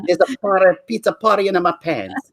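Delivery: high, hammed-up Italian accent. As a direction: deliver a cheesy pickup line in mock Italian. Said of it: "I love the Italian".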